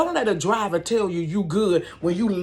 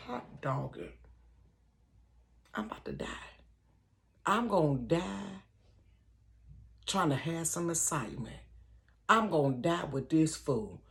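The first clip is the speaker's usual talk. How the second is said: hushed dread; 7 dB quieter than usual. Retold or imitated. To herself in the car, hushed and fearful. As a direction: murmur it like you're about to die. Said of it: "I said".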